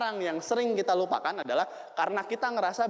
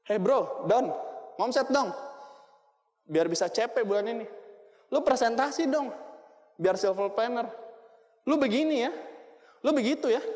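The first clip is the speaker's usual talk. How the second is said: lightly voiced pushy upline, higher; bossy upline; 5 semitones higher than usual. Upline pushing his downline to sell more, mild pushy nagging. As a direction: nag the downline like a hustler boss. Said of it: "sering ngomong".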